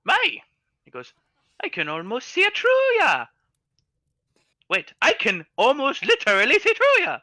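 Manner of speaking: high, loud tipsy gnome. Acted. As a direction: squeak it out like a tiny drunk gnome.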